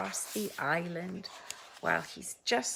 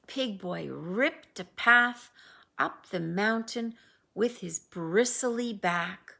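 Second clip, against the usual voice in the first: plain reading voice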